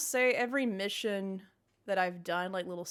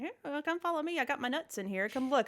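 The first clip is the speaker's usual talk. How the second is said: lightly voiced cheeky squirrel, higher; 6 semitones higher than usual. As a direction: beckon like a sly little squirrel. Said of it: "the squirrel might be in a little spaceship saying".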